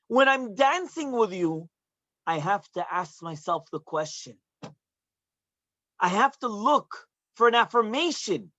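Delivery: ordinary talk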